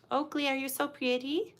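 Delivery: high, soft sing-song to a dog; cooing pet talk. Real, not acted. Talking to her freshly groomed little dog, cooing. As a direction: coo softly, pitch up, rising at the end.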